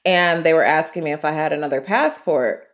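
ordinary talk

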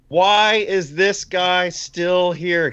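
high, drawn-out fan's yell; slow, high-pitched heckle from the stands; retold heckling yell. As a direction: imitate a yelling fan. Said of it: "He's yelling at Dustin Wolf".

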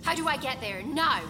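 urgent demand. Acted. Doctor demanding the AI's route to the nursery, urgent and loud. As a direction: insist right now, no time to waste.

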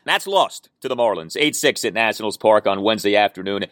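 ordinary talk

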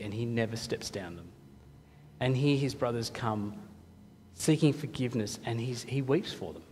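low, very soft storytelling; hushed, tender reverence. Real, not acted. Preacher telling the congregation how Joseph wept for his brothers, hushed and tender. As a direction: drop low and quiet, dwelling on it tenderly. Moved retelling of Joseph weeping.

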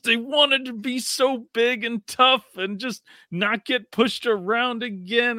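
high, slow sarcastic tone; mocking tough-guy wish. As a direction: sneer at their fake toughness. Mocking the Rams again.

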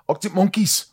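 very high mimicked northern English voice; imitated northern accent. Host imitating how he said the band's name, high northern accent. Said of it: "The way he said it".

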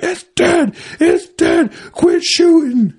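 high, loud, slow shout across the woods; dad shouting. Retold or imitated. Dad yelling at the other hunters to stop. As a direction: yell it out to stop the shooting. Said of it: "He's like".

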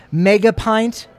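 usual speaking voice

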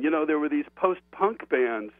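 usual speaking voice

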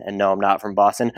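usual speaking voice

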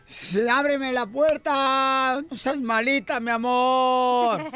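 put-on very drawn-out drunken wheedling; pleading drunk. Drunk husband begging at the locked door, slow wheedling. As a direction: slur it slowly like a drunk begging. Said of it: "llega un borracho".

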